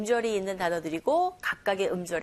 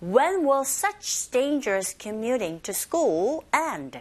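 slow, stretched model of the whole sentence; much slower than usual. Shown as a demonstration. Teacher gives the full sentence to repeat, slowly. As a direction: say it slowly for learners to copy. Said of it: "One more time".

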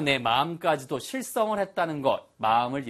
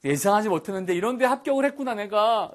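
higher, fast, delighted disbelief; delighted surprise; 7 semitones higher, faster than usual. Shown as a demonstration. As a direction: sound thrilled to be hired. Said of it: "해결된 느낌". (높고 빠르게 기뻐하며 놀란 말투)

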